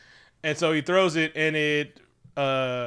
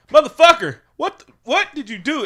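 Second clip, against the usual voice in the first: much higher, much louder shocked yell; outraged yell; 12 semitones higher, 9 dB louder than usual. Retold or imitated. She yells at him for revealing his name, outraged. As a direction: blow up at him in disbelief. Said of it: "And she was like".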